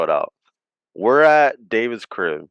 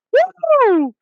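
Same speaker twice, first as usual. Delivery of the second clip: high, loud imitated "woo"; hyped whoop; 5 dB louder than usual. Retelling a friend's bottle throw, hyped whoop. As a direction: let out a long, high party whoop. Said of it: "He was hyped".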